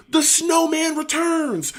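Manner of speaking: high, slow, dramatic announcement; mock snowman. [name] grabbing a second extinguisher, slow high proclamation. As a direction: announce your return like a movie villain. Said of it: "talking about".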